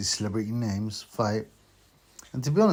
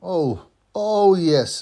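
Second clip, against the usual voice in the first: excited; 8 semitones higher, 10 dB louder, much slower than usual. Real, not acted. Excited intro greeting.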